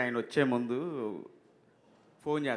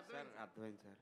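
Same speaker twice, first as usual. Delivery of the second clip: much softer, timid reply; meek assistant; 4 semitones lower, 18 dB quieter, faster than usual. Retold or imitated. Assistant answering the angry director, very soft and meek. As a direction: shrink back and answer softly.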